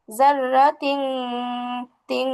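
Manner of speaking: জাররা তিং ending in a long nasal "ng"; stretched nasal "ng" on তিং; ghunna like anusvara. Shown as a demonstration. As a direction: hum the end of তিং through the nose and hold it. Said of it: "বাংলায় অনিশ্বারের মতো উচ্চারণ হবে".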